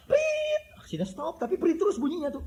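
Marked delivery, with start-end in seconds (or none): 0.1-0.8 very high, shrill whistle blasts; mock whistle blasts from a traffic cop; police whistle imitation. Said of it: "Kalau dia mau stop".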